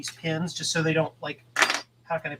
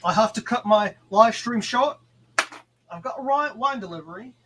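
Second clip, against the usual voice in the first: put-on Australian accent; 6 semitones higher than usual. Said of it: "my Australian imitation was bad".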